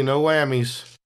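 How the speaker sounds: ordinary talk, nothing marked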